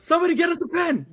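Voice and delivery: high, frantic mimicked shout; freaking out. Friends without a pen for the autograph, shouting frantically. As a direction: yell urgently to anyone within earshot. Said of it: "Doug is freaking out".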